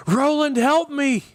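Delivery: piercing, high scream for help; desperate shriek. A narrator's reading. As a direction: scream out to be saved. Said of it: "he shrieked".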